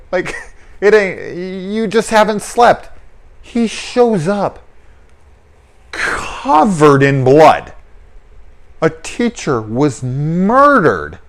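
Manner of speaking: high, slow, incredulous listing of the red flags; exasperated disbelief. Real, not acted. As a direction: spell out every warning sign to her, slowly. Exasperated rant at the heroine.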